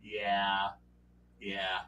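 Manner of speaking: sinister game master trailing off into a hush; ominous fading yeah. Retold or imitated. Game master teasing the panicked players, fading to a menacing hush. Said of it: "And I'm like".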